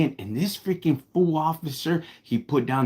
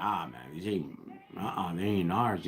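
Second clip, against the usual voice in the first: mumbled denial; 6 semitones lower, 9 dB quieter than usual. Retold or imitated. Denying the stolen cars to cops.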